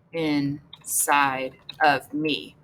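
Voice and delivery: slow, low word-by-word dictation; drawn-out composing. Real, not acted. Writing out a poem line, drawn-out and low. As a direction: stretch each word while writing it down.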